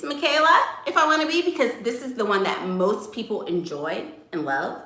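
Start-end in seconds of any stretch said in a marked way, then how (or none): 0.0-1.4 a higher persona voice; switched-up persona voice. Shown as a demonstration. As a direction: switch up into the crowd-pleasing persona. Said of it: "So I can switch it up".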